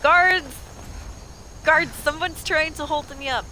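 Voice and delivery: high shout easing into an appeal; role-played cry for help; calling for help. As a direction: call the guards over.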